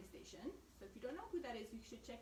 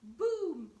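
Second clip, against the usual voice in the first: louder and slower, savouring the boom; noisy booming bear; 4 semitones higher, 12 dB louder than usual. A narrator's reading. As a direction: let the boom ring out like a big drum. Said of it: "the noisy bear".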